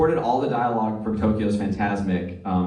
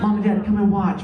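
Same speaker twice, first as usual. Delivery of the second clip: put-on high, excited kid calling out; child calling parents; 10 semitones higher, 4 dB louder than usual. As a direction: call Mom and Dad over, pitched up high.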